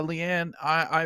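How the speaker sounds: ordinary delivery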